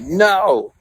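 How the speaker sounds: high loud protest; mock protest. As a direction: yell no at yourself. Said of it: "And I'm like".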